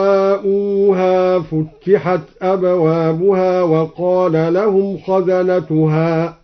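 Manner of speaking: slow chant with فتحت said "futtihat", t doubled; doubled consonant in futtihat. Shown as a demonstration. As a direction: recite slowly, press the doubled t in فتحت. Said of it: "قرأ الدوري بتشديد الفاء دلالة على كثرة التفتيح هكذا".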